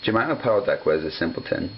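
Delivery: ordinary delivery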